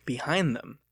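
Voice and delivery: ordinary delivery, nothing marked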